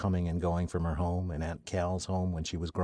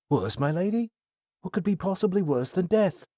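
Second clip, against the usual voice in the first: put-on higher, baffled young man; puzzled young man; 10 semitones higher, 3 dB louder than usual. Kai questioning Athena's teasing, puzzled. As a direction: ask in disbelief.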